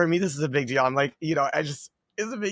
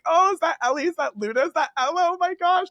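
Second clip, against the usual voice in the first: high, squealing fan voices, much higher and louder; excited fans; 14 semitones higher, 4 dB louder than usual. Retold or imitated. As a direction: gush like thrilled young fans spotting stars. Fans recognizing creators excitedly.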